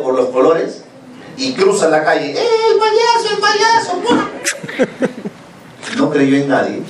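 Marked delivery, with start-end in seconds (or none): 2.3-4.3 put-on high-pitched squeal of a delighted kid; excited child. Child calling out on seeing the clown, excited squeal. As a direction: voice a child thrilled at a clown, far above usual pitch.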